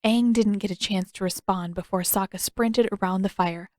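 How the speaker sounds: ordinary delivery, nothing marked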